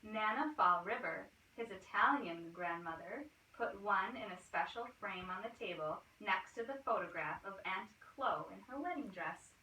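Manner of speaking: ordinary delivery, nothing marked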